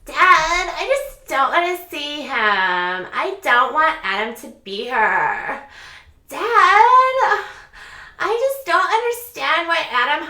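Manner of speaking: put-on high, loud whiny teen voice, near tears; shrill whining Chelsea complaining to Dad in the car; Chelsea impression. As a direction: whine like a spoiled daughter begging dad. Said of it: "my Chelsea impression".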